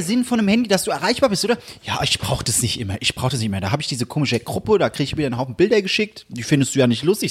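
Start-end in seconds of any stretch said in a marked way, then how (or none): 1.8-7.3 low, grumbling dad voice; imitated Hessian father. Father defending leaving his phone behind, low imitated Hessian grumble. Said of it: "andere Personen auf Hessisch nachmachst".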